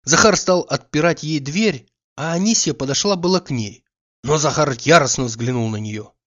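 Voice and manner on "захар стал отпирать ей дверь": ordinary delivery, nothing marked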